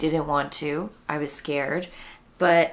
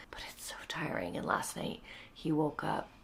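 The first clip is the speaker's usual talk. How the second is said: whisper; 8 dB quieter than usual. Real, not acted. Baby sleeping nearby.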